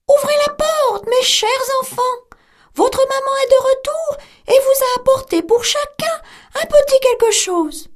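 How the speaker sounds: high, sweetened wolf voice; the wolf faking a gentle mother; wolf pretending to be mother goat, high and sweet; wolf's softened voice. A narrator's reading. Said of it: "sa voix devint plus douce".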